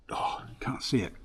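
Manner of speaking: breathy whisper to himself; muttered whisper at the screen; whispered aside. Real, not acted. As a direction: whisper it, half to yourself. Aside while fumbling with screen.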